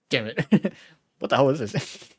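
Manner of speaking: loud, exasperated curse; frustrated outburst. Real, not acted. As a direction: blurt it out in frustration. Wrong answer frustration.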